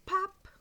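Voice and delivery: high pop sound. Acted. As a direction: pop it out like a flying button. Button popping off in story.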